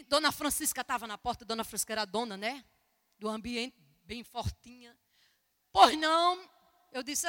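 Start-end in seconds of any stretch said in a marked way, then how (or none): 5.7-6.4 louder, higher acted challenge; curt challenge. Brothel owner answering a stranger at the door, curt challenge. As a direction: throw it out loud as the wary owner.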